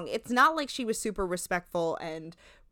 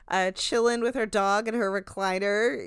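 ordinary talk

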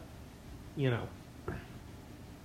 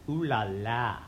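mock French; 5 semitones lower, slower than usual. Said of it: "as they say in France".

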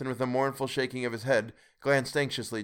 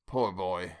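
pitying; 5 semitones lower than usual. A narrator's reading. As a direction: sigh it out for his former self. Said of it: "he said in pity for his former self".

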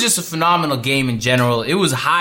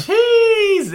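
sudden high yelp; surprised shout; 4 dB louder than usual. Real, not acted. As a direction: jump at being called on. Reacts to being put on spot.

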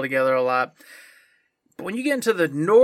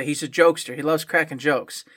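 ordinary talk

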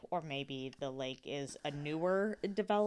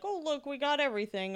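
mock excited; 8 semitones higher, faster than usual. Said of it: "They're like".

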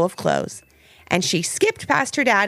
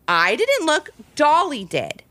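higher, sly little denial; playful half smile; 8 semitones higher, slower than usual. A narrator's reading. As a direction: blame the doll with a half smile. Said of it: "with a half smile".

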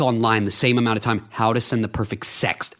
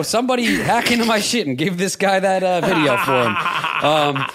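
laughing; 5 semitones higher than usual. Real, not acted. Laughing as he dares listeners to hack him. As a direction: giggle it out, half joking. Laughing while asking to be hacked.

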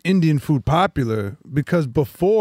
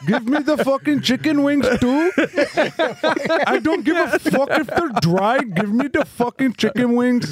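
higher, angry stick-up demands; mock robber yelling; 9 semitones higher than usual. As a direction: bark orders at the clerk in a comic rage. Acting out a gas station robbery.

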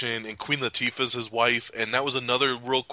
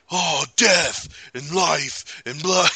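mock slam poet; 6 semitones higher, slower than usual. Mock slam poetry recital.

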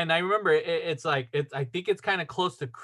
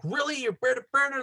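mildly indignant, higher-pitched blurt; retold mad outburst; 8 semitones higher, faster than usual. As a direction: blurt it higher, mock-mad, without shouting. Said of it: "I was mad".

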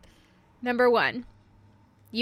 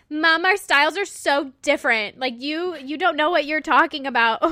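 louder, higher mock teenage protest; exasperated teen retort; 4 semitones higher, 6 dB louder than usual. Daughter re-enacting high school clothes quarrel with mom, exasperated protest. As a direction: push back at Mom, playfully overdone.

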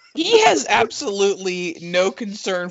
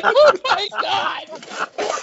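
laughing; much slower than usual. Retold or imitated. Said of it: "I'm like".

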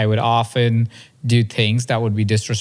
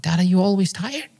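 put-on higher child voice, rising into a question; small child's questioning voice; imitated toddler son; 8 semitones higher than usual. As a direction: ask it innocently like a two-year-old. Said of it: "He said".

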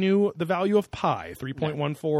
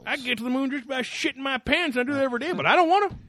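high mock outraged voice; high silly voice; 10 semitones higher, faster than usual. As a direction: whine it up high.

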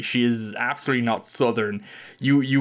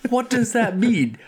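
surprised; 11 semitones higher than usual. Real, not acted. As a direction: blurt it out in disbelief. Baffled by the quoted line.

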